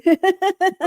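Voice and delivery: high bright burst of laughter; delighted laugh. Real, not acted. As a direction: laugh off the chant. Laughs after the chant.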